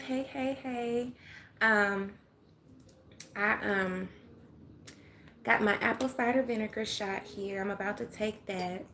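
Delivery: ordinary delivery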